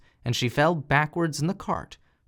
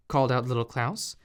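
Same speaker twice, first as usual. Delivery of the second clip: ordinary delivery, nothing marked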